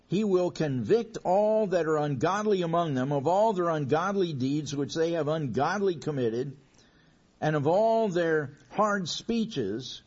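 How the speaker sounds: loud, ringing scripture reading, slowing on hard speeches; preaching scripture. Acted. Preacher reading Jude to his church, loud and ringing. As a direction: thunder the verse out, then slow down.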